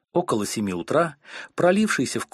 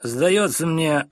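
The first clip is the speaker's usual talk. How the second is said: slow, louder, talking through food; mouth full; 5 semitones higher, 4 dB louder, much slower than usual. Acted. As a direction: chew the words out. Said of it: "выговорил мистер Корнер с полным ртом". (говорит с полным ртом)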